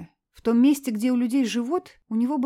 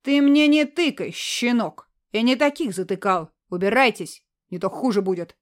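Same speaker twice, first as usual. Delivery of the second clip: angry threat; 5 dB louder than usual. Acted. Man threatening kids.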